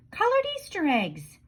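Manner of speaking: declaring. A narrator's reading. Desmond announcing his plan, eager and pitched high. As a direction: proclaim it like a winning idea. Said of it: "declared Desmond".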